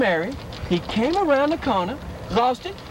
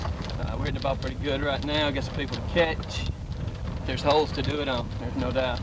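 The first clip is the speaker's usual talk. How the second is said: low mock golfer voice; 10 semitones lower, 5 dB quieter than usual.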